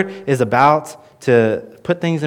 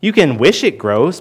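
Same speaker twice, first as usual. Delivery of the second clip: normal talking voice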